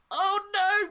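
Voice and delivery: high, loud mock panic before getting shot; mock dread. Playing Bond who knows he's about to be hit, mock panicked yelp. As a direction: squeal like you know the shot is coming. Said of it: "would just go".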